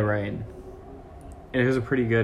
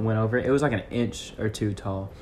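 usual speaking voice

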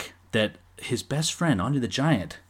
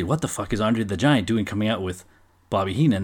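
usual speaking voice

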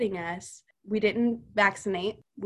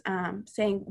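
usual speaking voice

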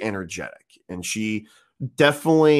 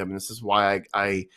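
normal talking voice

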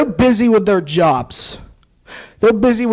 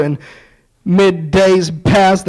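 ordinary talk, nothing marked

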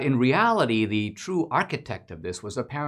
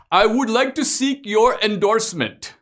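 mock Indian accent, high and loud; a polite immigrant pitching himself, hammed-up high voice; Mister Gadde; 12 semitones higher, 6 dB louder than usual. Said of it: "imaginative rendition".

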